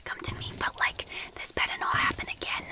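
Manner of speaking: usual speaking voice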